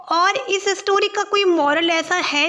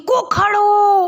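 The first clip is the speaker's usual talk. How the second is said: calling out; 5 semitones higher, 5 dB louder, much slower than usual. Acted. Calls again louder.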